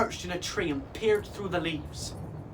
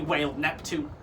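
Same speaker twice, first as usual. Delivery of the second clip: ordinary delivery, nothing marked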